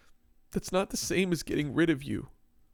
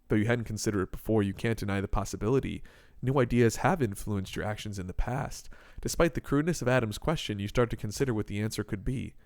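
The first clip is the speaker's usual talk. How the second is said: low, even narration voice; second person narrator; 8 semitones lower than usual. A narrator's reading. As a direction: read it as calm inner narration.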